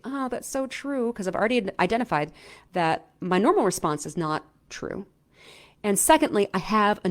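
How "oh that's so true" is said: higher, slow, softer sigh of agreement; mock weary agreement. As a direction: give in with a slow, defeated "so true". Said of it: "some kind of vague".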